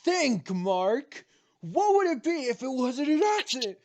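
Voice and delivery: Omni-Man impression. Quoting Omni-Man meme line.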